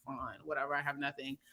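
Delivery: low, soft resigned mutter; resigned giving up. Retold or imitated. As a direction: give up under your breath. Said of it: "And you're just like".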